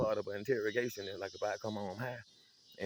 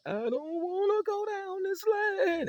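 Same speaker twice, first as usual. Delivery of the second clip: whiny little brother; 8 dB louder, much slower than usual. Retold or imitated. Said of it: "he started off real low".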